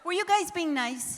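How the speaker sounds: loud, playful call to the room; rally the crowd with a grin; teasing shout. Real, not acted.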